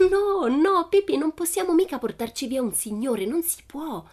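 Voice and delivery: high, alarmed protest; terrified. A narrator's reading. Tommy objecting to carrying a man off, terrified. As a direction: plead in panic, pitched well up. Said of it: "la guardarono terrorizzati".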